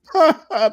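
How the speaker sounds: a sudden high laugh at his own joke; burst of laughter. Real, not acted. Coach laughing at his own joke to job seekers, loud high laugh.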